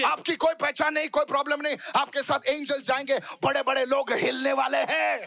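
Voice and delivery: fast, high-pitched rallying sermon; fired-up preacher racing to a climax; full-force preaching. Real, not acted. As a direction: build to a shouted promise.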